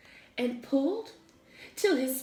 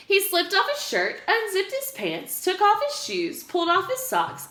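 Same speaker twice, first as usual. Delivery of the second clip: lively; 8 semitones higher than usual. A narrator's reading. Fast undressing list.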